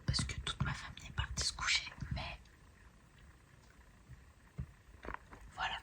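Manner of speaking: whispering so the family sleeps; hushed late-night whisper. Real, not acted. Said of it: "Je peux pas parler plus fort que ça".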